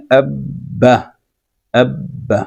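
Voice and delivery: اب then با, split apart with a pause to show the doubled با; syllable by syllable. Shown as a demonstration. Teacher showing students how shadda doubles با, sounding out اب … با. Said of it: "تو اس کو آپ نے کیسے پڑھنا ہے".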